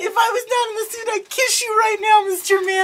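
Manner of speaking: high, squeaky put-on voice; hammed-up falsetto gushing; mock swooning heroine. Acts out Felicity in the suit.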